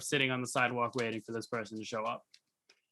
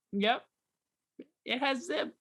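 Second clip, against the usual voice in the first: forced-agreeable yep; 10 semitones higher than usual. Retold or imitated. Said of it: "And I'm just like".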